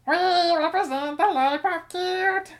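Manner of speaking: high, slow, squeaky chipmunk voice. Acted. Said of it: "Speed that up".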